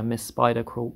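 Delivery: plain reading voice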